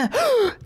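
high delighted gasp; excited gasp. Real, not acted. Player seeing a kitten born, delighted gasp. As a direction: gasp at the surprise.

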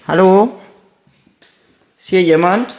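loud call into the dark. Real, not acted. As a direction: shout hello into the empty hall. Calling into the dark.

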